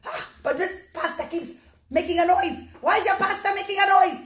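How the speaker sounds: king yelling about noisy pasta; angry king. Acted. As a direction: demand an answer from the servant. King accusing servant about pasta.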